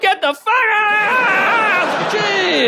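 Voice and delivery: woman caught on the toilet screaming him out; outraged shriek at the intruder; furious shout. Retold or imitated. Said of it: "She was like".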